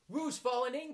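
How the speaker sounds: high, loud alarmed cry. A narrator's reading. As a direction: call out the alarm. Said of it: "cried Rabbit".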